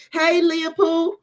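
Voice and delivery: high, slow drawn-out hello; greeting a viewer, high and slow; sing-song greeting. Real, not acted. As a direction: stretch the name out high.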